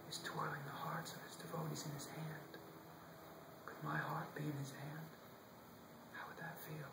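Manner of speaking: hushed, wondering inner voice; a soft, wonder-filled whisper of private longing; dreamy whisper. Acted. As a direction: whisper it, lost in a daydream. Boy lost in sweet reverie.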